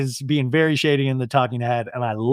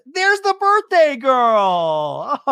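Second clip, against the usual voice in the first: higher, very slow gushing welcome; gushing greeting; 8 semitones higher, much slower than usual. Retold or imitated. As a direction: stretch it out like a gushing hostess. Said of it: "and Heather goes".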